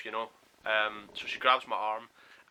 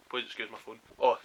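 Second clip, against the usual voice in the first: ordinary talk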